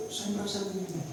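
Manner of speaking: soft, careful statement of feeling; gentle complaint. Retold or imitated. Husband hoping to calm his wife, soft and careful. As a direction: say it softly to calm things down. The tip as he imagined it.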